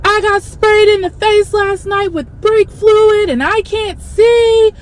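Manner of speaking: high-pitched retelling of a man's shout; half-hearted imitation of a yelling stranger; a man tells the theater he can't see, mock-shouted. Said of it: "shouting and saying".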